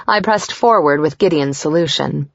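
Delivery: usual speaking voice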